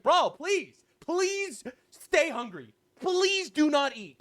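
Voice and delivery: high, slow, mocking plea; mock pleading. Mocking hunger strikers, slow high pleading. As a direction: drag out a fake begging plea.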